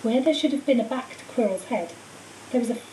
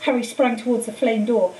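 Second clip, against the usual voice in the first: ordinary delivery, nothing marked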